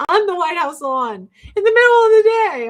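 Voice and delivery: normal talking voice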